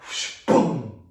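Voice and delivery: whoosh then a loud boom; door slam noise. Shown as a demonstration. Teacher acting the flat door slamming shut, whoosh boom. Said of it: "Could you do an impression of my door".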